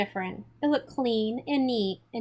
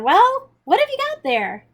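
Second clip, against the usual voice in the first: big booming fatherly call, much higher and louder; 13 semitones higher, 8 dB louder than usual. A narrator's reading. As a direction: boom it out like a hearty father greeting his son. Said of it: "The booming voice of my father".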